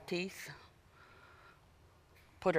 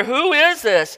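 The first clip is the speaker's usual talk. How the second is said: terrified question; 8 semitones higher, 3 dB louder, slower than usual. Acted. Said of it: "they were terrified and they asked".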